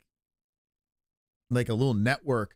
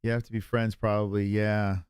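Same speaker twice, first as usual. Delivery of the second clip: ordinary delivery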